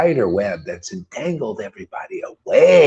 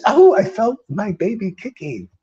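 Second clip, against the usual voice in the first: mock mother; 7 semitones higher than usual. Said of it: "You know how people say".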